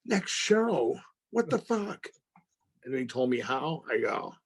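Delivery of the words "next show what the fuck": stunned disbelief. Retold or imitated. Learning he dies next episode.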